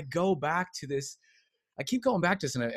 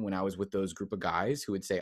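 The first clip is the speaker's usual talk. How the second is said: normal talking voice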